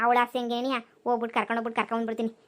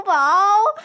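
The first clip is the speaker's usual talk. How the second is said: high, loud wow of delight; thrilled gasp at the dress; excited wow; 7 semitones higher, 8 dB louder, much slower than usual. Acted. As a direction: let the wow burst out. Admiring a dress.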